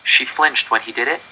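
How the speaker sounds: ordinary delivery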